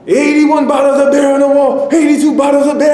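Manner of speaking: high, very loud screeched song count; frenzied high-pitched bellowing; manic screeching. Retold or imitated. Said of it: "he started screeching at him loudly".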